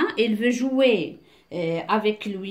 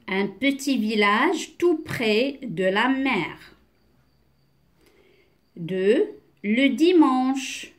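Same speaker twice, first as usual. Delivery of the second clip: slow, deliberate read-aloud; measured reading with long pauses between phrases; teacher's model reading; slower than usual. Shown as a demonstration. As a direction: read word by word for beginners. Reads text to beginners.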